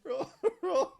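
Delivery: high laughing urging; laughing plea. Real, not acted. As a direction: beg them to roll while laughing. GM urging dice roll, laughing.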